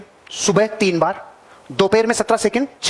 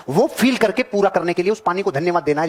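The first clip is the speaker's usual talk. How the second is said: normal talking voice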